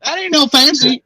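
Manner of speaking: very high, mock-indignant [name] accent; terrible [name] accent. [name] slamming down the host's door, terrible [name] accent. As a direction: squeal it in a hammed-up [name] twang. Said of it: "that was a terrible [name] accent".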